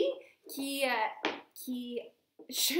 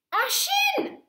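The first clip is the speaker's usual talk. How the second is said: very high, loud exclamation; bold answer; 8 dB louder than usual. A narrator's reading. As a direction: announce it like a grand plan.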